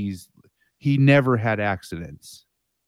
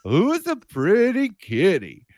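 high, slow cooing at a cat; baby-talk the cat, up high; kitty voice; 6 semitones higher, slower than usual. Pretend. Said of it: "my voice now is like".

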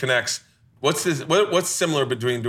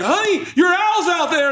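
wife calling out; 5 dB louder, slower than usual. Retold or imitated. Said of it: "his wife's like".

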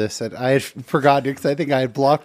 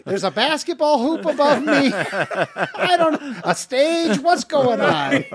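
high, laughing, slow at the end; mock bewildered outsider; 9 semitones higher, slower than usual. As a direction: play the baffled visitor. Mock outsider shocked by priests.

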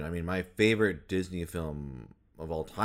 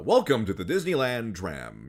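loud, raised-pitch tram announcer voice; theme park announcer; 5 semitones higher, 6 dB louder than usual. Acted. Announcer welcoming riders to the Disneyland tram, put-on announcer voice. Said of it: "he does a lot of the".

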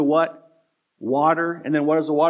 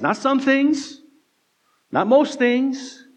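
some and most lifted high and drawn out; emphatic contrast; 8 semitones higher, slower than usual. Acted. Preacher hammering home to the church, high and emphatic. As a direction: lean hard on some and most.

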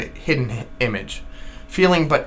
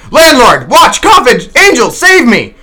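much higher, much louder panicked cry; a panicked man shouting for rescue in the dark; shouting for help; 11 semitones higher, 10 dB louder, slower than usual. Acted. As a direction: yell for the landlord in terror. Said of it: "shouted I".

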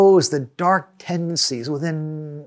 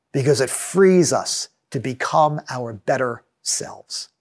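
ordinary talk, nothing marked

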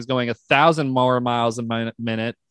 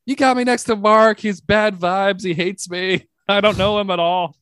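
raised-pitch ranting complaint; going nuts, re-enacted; 6 semitones higher, 3 dB louder than usual. Comic going nuts after a joke bombed, high-pitched rant. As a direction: rant in a higher voice, mock meltdown. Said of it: "I'm just going nuts".

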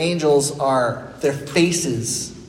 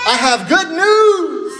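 high, loud, slow herald yell; yeller demo; 12 semitones higher, 5 dB louder, slower than usual. Shown as a demonstration. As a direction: belt it out like a herald. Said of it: "I want a, a yeller".

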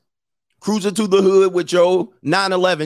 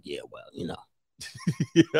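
slow, soft chuckle through it; sheepish, laughing shrug; awkward laughing brush-off; 2 semitones lower, 9 dB quieter, much slower than usual. Retold or imitated. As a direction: dodge the question with a quiet laugh.

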